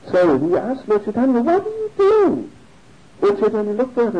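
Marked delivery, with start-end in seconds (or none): 1.4-2.5 much higher-pitched, mock-aghast cry; shocked question. Devotee confronts the boy eating his offering, mock-shocked. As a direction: act out an appalled demand.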